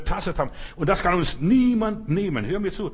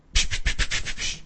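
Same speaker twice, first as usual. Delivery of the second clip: snake hiss. Retold or imitated. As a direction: hiss like the tempting serpent. Eva seduced by the snake. (zischen wie eine Schlange)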